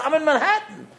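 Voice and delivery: very high-pitched retold reply; retold sheepish admission. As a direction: voice the caller way up high. Retold absent father's confession on phone.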